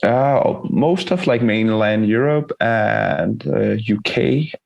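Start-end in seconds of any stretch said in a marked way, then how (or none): none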